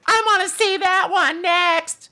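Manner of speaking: put-on very high, slow little-kid voice; little girl. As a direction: put on an eager tiny girl. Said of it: "the little girl".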